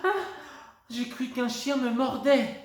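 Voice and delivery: high loud frightened cry; startled shriek. A narrator's reading. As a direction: hand on heart after a scare. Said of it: "La maman de Lou hurle". (cri de frayeur aigu)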